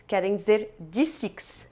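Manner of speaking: ordinary delivery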